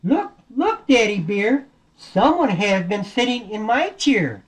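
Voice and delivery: high mama bear echoing the alarm. Acted. Mama Bear echoes about her chair.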